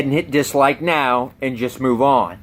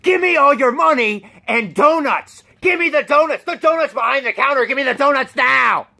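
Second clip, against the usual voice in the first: demanding robber; 8 semitones higher than usual. Retold or imitated. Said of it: "this guy's like".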